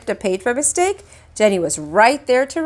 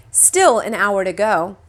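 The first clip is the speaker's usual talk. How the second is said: ordinary delivery, nothing marked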